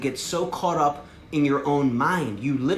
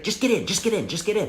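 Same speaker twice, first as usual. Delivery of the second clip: higher, insistent repeated urging; pushy friend; 7 semitones higher than usual. Retold or imitated. Frank pressing the speaker to join, insistent. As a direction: press him like an eager recruiter. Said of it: "He's like".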